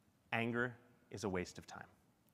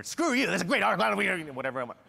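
loud, higher angry retort; mock fire-back; 8 semitones higher, 8 dB louder than usual. Speaker firing back at a bad article, mock anger. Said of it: "So I can't fire back".

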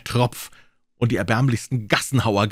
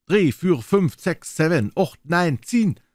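high, louder answering count; echoing count; 9 semitones higher, 4 dB louder, slower than usual. Acted. Little clocks answering the count in a high voice. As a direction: chime each number back.

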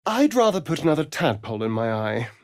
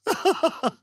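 high amused laugh. Acted. Vampire amused by his revenge.

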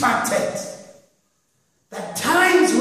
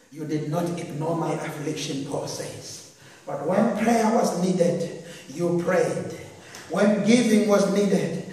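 lower, much softer closing read; quiet winding down; 7 semitones lower, 7 dB quieter than usual. Acted. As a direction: pastor gently closing out the reading. Closing section drops low and soft.

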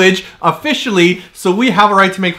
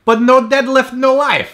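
high-pitched playful whine of protest; mock protest; 6 semitones higher than usual. Mock protest to physiotherapist's ban.

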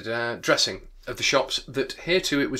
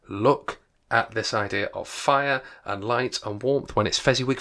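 ordinary delivery

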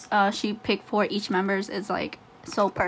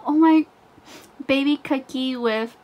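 usual speaking voice

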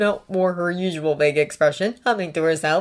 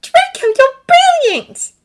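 high, slow, loud delight; elated high exclamation; thrilled; 9 dB louder, slower than usual. A narrator's reading. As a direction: gush it with excitement. Character exclaims at sudden realization.

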